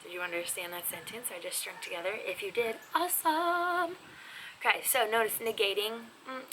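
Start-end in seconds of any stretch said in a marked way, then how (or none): 2.9-4.0 slow, higher, stretched awesome; sing-song slow awesome; drawn-out praise. Real, not acted. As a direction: savour the word like a cheer.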